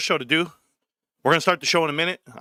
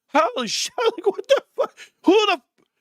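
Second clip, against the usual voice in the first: mock disbelief; 13 semitones higher than usual. Mock outrage at the Cowboys.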